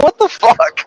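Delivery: high-pitched disbelieving what the fuck; incredulous outburst. Retold or imitated. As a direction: squeak it out in shock. Broker cancels and blocks number.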